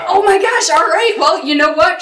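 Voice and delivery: high, loud, amazed and laughing; a game master caught off guard, giving in. Retold or imitated. GM reacting to both players rolling natural twenties, high and excited.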